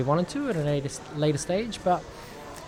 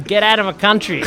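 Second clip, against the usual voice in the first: quicker, run-together Australian accent; raised-voice Australian demo; 6 semitones higher, 6 dB louder than usual. Shown as a demonstration. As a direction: say it loud in casual Australian. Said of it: "If you said it in Australian".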